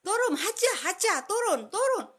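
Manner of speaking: high, loud shouts of get down; urgent crowd shouting turun; people yelling. Retold or imitated. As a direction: yell down at her from behind. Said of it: "Di belakang ini teriak-teriak".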